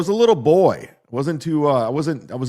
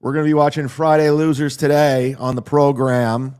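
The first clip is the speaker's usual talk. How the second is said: announcer; 4 dB louder than usual. Acted. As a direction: announce the show grandly. Host reopening the show.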